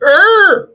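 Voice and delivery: high, loud mock roar of the crowd; outraged roar. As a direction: let out a hammed-up howl of outrage. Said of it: "everyone went".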